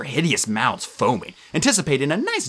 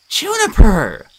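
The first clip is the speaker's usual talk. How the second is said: higher, louder startled cry of a name; 10 semitones higher, 9 dB louder, much slower than usual. A narrator's reading. Annabeth startled by the nymph, crying her name. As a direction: caught off guard.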